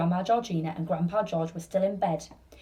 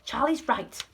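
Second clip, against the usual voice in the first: ordinary delivery, nothing marked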